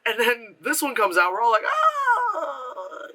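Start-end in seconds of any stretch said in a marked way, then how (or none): 1.6-3.1 long, high, drawn-out "ah" of dismay; exaggerated high wail; mock wail. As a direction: let out a hammed-up cry of disappointment. Mock reaction of audience to new film.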